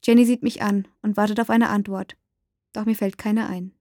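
plain reading voice

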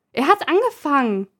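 high, loud shrieked protest; girl shrieking to her mother that he started it; shrill shriek. Acted. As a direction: screech it like a sulky child. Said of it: "kreischt sie".

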